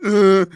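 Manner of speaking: loud grunt; hammed-up shark noise; mock shark grunt. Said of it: "the shark's going".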